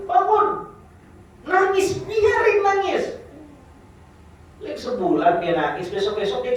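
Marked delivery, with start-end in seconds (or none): 0.1-3.2 high, loud call of "Bangun". Acted. Father waking crying baby. (seruan ayah membangunkan bayi, tinggi dan keras)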